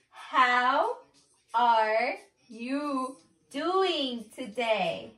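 very slow, one word at a time; slow exaggerated enunciation. Real, not acted. Asking if partner reads lips, very slow enunciation. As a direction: spell it out word by word with the mouth. Repeats it for lip reader.

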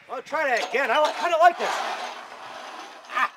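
high loud ride shouts; thrilled yelling. Real, not acted. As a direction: whoop through the ride. Riding zipline again.

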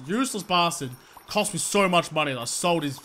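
ordinary delivery

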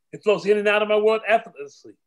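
usual speaking voice

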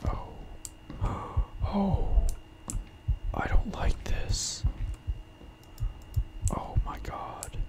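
gasp, then whisper slowly; frightened, barely voiced whisper; scared whisper. Real, not acted. Player who doesn't like this, scared whisper.

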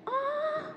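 high, soft angelic "ah"; mock-heavenly sung ah; awed gasp. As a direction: sigh in wonder on a high held note. Awe at a beautiful name.

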